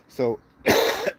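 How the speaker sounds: coughing through a word; words broken by a cough; coughing fit. Real, not acted. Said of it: "Sorry I'm coughing so much".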